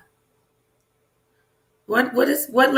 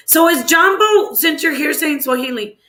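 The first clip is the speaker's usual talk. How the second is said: eager question; 7 semitones higher than usual. Real, not acted. Excited guessing Swahili word.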